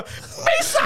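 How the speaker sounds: high, silly cartoon shriek; mock comic squeal; Jar Jar Binks. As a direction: burst in with a goofy high yell. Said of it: "it hard cuts to".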